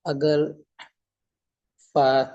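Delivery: ordinary talk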